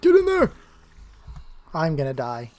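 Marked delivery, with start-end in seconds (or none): 0.0-0.5 high loud yell; frustrated yell. Real, not acted. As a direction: shout at the mobs. Yelling at mobs.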